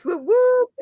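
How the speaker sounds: high, loud celebratory whoops; gleeful high-pitched cheer. Real, not acted. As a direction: whoop for the fans. Cheering for Hamilton fans.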